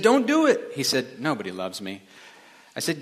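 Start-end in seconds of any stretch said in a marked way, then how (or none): 0.0-0.7 high, urgent cry to stop; alarmed, high-pitched appeal; urgent plea. Acted. Joke: pleading with bridge jumper. 1.2-2.0 low, soft, hopeless mumble; mock-glum, sunken voice; dejected. Joke: jumper voiced in despair.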